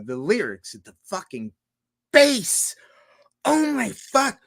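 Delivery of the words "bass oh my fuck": much higher, bursting with awe; raving delight. Real, not acted. About the bass in Tool songs, an awestruck high outburst. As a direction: gush over it like it blew your mind.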